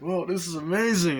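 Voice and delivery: high-pitched imitated enthusiasm; mock amazement. Passenger in the speeding car, mock amazement. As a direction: gush over it, hammed-up. Retold excitement during drive.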